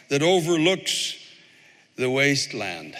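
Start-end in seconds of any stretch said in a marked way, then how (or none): none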